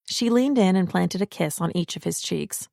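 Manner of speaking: plain reading voice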